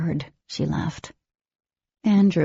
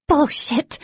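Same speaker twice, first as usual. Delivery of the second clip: hissed; 10 semitones higher, 5 dB louder than usual. Acted. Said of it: "she hissed".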